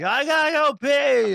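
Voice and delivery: imitating his son's high, loud, slow bathroom announcement. Son bursting the bathroom door open, loud high announcement. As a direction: boom it out so the whole house hears. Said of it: "And the whole world knew".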